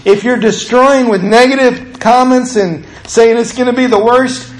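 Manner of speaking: high, driving sermon voice; full-force preaching. Acted. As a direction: preach it hard to the congregation.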